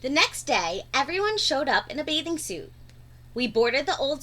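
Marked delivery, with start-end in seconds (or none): none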